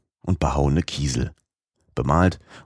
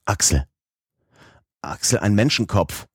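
trembling voice; 3 semitones higher than usual. A narrator's reading. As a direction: voice shaking with shock, calling out. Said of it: "Mit zitternder Stimme rief er".

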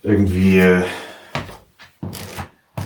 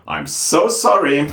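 mock apology; 6 semitones higher, much slower than usual.

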